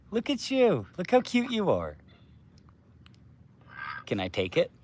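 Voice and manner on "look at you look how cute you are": much higher, softer cooing. Real, not acted. Owner coos high at her fluffy duck. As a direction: coo high at the cute duck.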